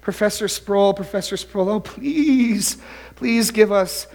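high-pitched, hammed-up begging students; mock-frantic begging for mercy; quaking plea. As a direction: plead desperately, drawing out "please". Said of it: "five students sort of shaking and quaking".

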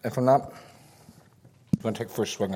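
talking with a mouthful; words round a bite of food; mouth full. Real, not acted. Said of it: "I got my mouth full".

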